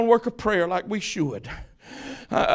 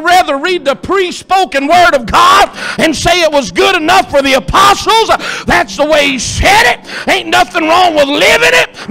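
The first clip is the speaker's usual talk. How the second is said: raised, loud preaching climbing into high shouts; preaching building to full force; 9 semitones higher, 10 dB louder than usual. Acted. Preacher urging the church to ask, seek and knock, full-force shout.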